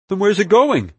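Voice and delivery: high, loud, urgent question; alarmed question. A narrator's reading. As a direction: press for an answer in alarm. Alarmed gunman presses for answer.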